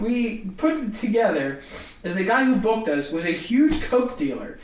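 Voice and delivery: ordinary delivery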